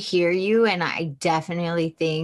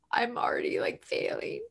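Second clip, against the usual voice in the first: choked, softer words breaking into a whispered cry; real tearful; 5 dB quieter than usual. Real, not acted. Author choking up as she recalls failing her mother, real tears. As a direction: let the tears catch the words. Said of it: "oh my God, I'm gonna get emotional".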